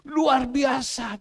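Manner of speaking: slow, loud, drawn-out cry of wonder; amazed exclaim. Retold or imitated. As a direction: stretch out the praise in awe. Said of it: "dia bilang".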